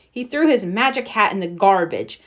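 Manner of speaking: usual speaking voice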